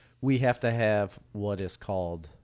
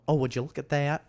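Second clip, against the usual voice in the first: imitating; 4 semitones higher than usual.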